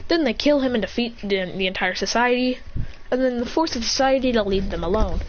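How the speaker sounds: ordinary delivery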